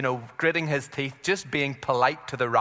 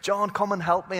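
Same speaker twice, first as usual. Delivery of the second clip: higher, urgent mock shout across the water; retold call for help; 7 semitones higher than usual. Peter calling his partners over the water, a mock urgent shout. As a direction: call out to the other boat for help, pitched up. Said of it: "He has to shout over to his friends".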